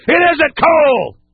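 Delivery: high and slow, each word hit; stern shout. Real, not acted. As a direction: slam it down hard. Scolding drug culture.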